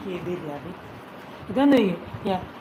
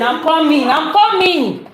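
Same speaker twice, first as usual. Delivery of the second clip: loud, higher call across the house; 7 semitones higher, 9 dB louder, slower than usual. Real, not acted. Answering mother's call with a loud shout. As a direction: yell back that you're on your way.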